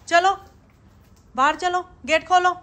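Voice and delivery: high, loud urging; calling the pet. Real, not acted. Owner urging her pet to the gate, high loud calling.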